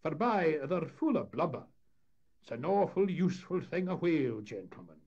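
high, loud Scots character voice; skipper as showman. Acted. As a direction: chime in with facts for the gentlemen.

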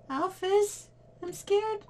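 very high squeaky fright; hammed-up high terrified squeak; mock scared.

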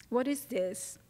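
ordinary delivery

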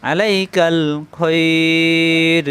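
আলাইকাল খৈর with the final ai held long; drawn-out recitation. Shown as a demonstration. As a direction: chant আলাইকাল খৈর very slowly, stretching the vowels. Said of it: "এক আলিফ অথবা দুই আলিফ টানতে হয়".

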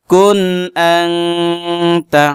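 আনতা with its n hidden in the nose and held long; nasal ghunna. Shown as a demonstration. Teacher showing viewers ikhfa, long nasal ghunna. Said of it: "উচ্চারণ করলাম নাকের ভিতরে লুকাইয়া".

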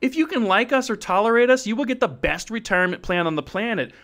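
usual speaking voice

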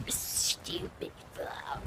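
slow drawn-out whisper. Shown as a demonstration. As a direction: whisper the title, slowly. Repeats title in whisper.

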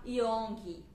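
put-on young said as "youngi", extra i vowel tacked on at the end; young with a Portuguese-style final vowel; wrong with extra vowel. Shown as a demonstration. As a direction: say young the wrong way, ending in an added i sound. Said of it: "a gente não coloca essa vogal no final".

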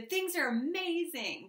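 high, slow, gushing amazement; overly enthusiastic. Shown as a demonstration. The Aries overselling their life, gushing. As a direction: oversell it with a sweet lift. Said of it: "as opposed to".

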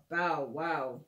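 playful low doggy woof; dog bark. Pretend. Dog barks in storybook.

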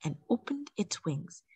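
plain reading voice

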